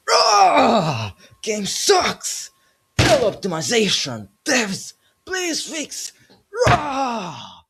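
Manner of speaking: high-pitched, loud roaring rant; mock raging gamer. Parodying angry players' complaints to the devs, hammed-up rage. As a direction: scream it like an over-the-top furious player. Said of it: "I'm not gonna be swearing or being like".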